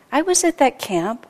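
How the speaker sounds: usual speaking voice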